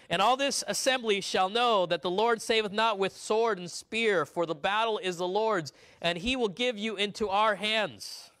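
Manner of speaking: high, loud and slow proclamation; young David's boast. Acted. David boasts to Goliath before the battle, high and loud. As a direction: declaim it like a bold boy warrior. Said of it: "Not done".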